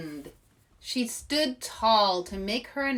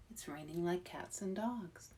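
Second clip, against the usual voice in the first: much softer, to himself; thinking aloud; 3 semitones lower, 14 dB quieter than usual. Acted. Arnold thinking alone in the rain, very soft. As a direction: murmur it to yourself.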